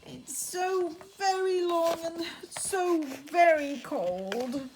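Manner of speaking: a droopy giraffe's lament. Acted. Giraffe voice in story.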